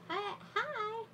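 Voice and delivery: high squeaky hi, hi; delighted high hi; squealed hi. Real, not acted. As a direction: squeal a greeting. Squealed greeting to viewer.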